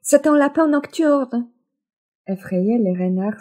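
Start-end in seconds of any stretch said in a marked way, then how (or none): 0.0-1.5 high, loud bluffing cry. Acted. Mother leaping to protect her son from the fox, shout. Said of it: "bondit en criant".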